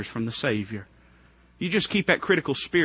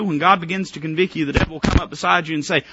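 usual speaking voice

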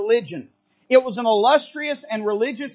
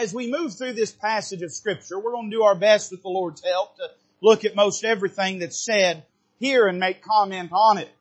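ordinary talk, nothing marked

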